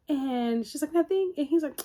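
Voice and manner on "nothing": high, loud mock-innocent denial; pitched-up, too-quick innocent act; feigned innocence. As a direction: imitate someone caught and denying it. Acts her hiding the girdle.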